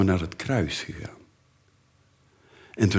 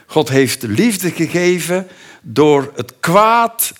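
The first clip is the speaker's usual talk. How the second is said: high, loud, slow proclamation; preaching climax; 8 semitones higher, 7 dB louder, slower than usual. Acted. Preacher tells the church how God gave love, loud and slow. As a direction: proclaim it slowly from the pulpit.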